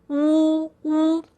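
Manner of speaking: wu held level with the tongue curled at the back. Shown as a demonstration. Teacher showing learners tongue position, wu as a held back vowel. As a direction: round the lips, pull the tongue back for wu. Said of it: "tongue is curled at the back".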